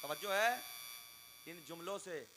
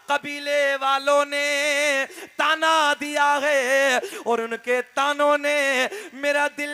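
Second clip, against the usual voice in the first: fervent, drawn-out preaching delivery; 6 semitones higher, 16 dB louder, slower than usual. Acted. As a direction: preach the scene to the crowd with feeling. Preacher narrating a naat story. (जोशीला वाअज़ का अंदाज़)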